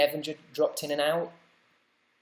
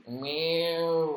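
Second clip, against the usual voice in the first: cat meow. Pretend. The overweight cat joke.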